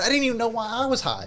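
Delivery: usual speaking voice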